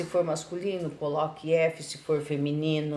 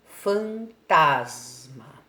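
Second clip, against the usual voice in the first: Fantasma said very slowly and louder, leaning on tas; slow syllable demo; 6 dB louder, much slower than usual. Shown as a demonstration. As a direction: stretch out Fantasma so the class hears the strong syllable tas. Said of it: "Qual que é mais forte?". (Fantasma bem devagar e mais alto, destacando a sílaba tas)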